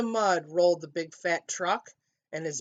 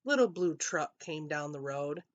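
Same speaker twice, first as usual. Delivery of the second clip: ordinary delivery, nothing marked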